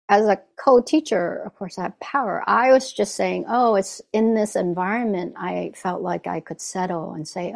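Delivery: ordinary talk, nothing marked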